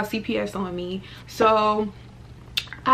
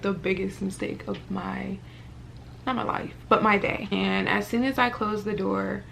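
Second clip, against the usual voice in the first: usual speaking voice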